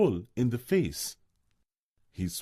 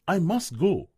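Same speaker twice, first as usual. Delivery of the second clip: put-on higher, louder cry of a young deer; urgent cry; 9 semitones higher, 4 dB louder than usual. A narrator's reading. Bambi crying out to the old stag, higher and urgent. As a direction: burst out, unable to wait. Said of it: "cried Bambi".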